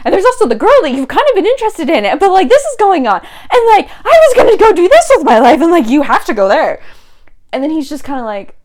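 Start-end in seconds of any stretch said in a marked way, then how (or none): 0.0-6.8 frantic babbling. Acted. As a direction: babble faster and louder as if panicking. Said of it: "she starts, like, babbling".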